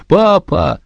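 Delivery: put-on high crying-out little boy; child's cry. A narrator's reading. Joe crying out to the sergeant in a high child's voice. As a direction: call out for papa.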